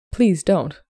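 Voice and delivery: a touch of flustered pleading; gently insist, a bit flustered. A narrator's reading. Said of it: "appearing a bit flustered".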